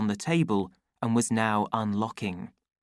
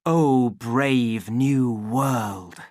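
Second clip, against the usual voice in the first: loud, very slow, unraised pitch; challenge, a command; 5 dB louder, much slower than usual. A narrator's reading. Savage hears it as a challenge, commanding. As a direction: lay it down as a weighty challenge. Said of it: "a command".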